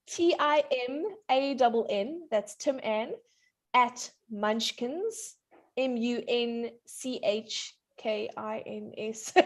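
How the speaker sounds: slow, careful spelling for someone writing it down. Real, not acted. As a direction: spell it out slowly, piece by piece. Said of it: "slow down".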